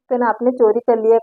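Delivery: normal talking voice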